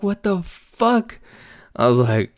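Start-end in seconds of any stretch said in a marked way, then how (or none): 0.0-1.2 drawn-out disbelief. Retold or imitated. Buddy's indignant reaction retold.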